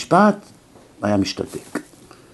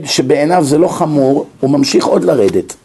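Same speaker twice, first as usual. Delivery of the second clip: ordinary talk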